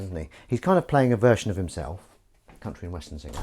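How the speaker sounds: ordinary talk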